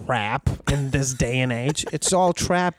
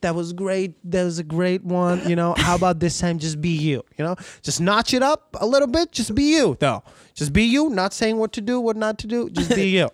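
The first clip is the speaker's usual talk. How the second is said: higher-pitched coaxing, imitating a client; mock encouragement, skirting the real request; tiptoeing client; 6 semitones higher than usual. Client hinting to the voice actor without saying accent, imitated coaxing. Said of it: "just walk around it as much as they can".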